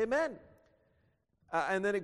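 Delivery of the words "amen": high-pitched called-out amen; imitating congregational response. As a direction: answer as the assembled people would. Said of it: "all the people shall say".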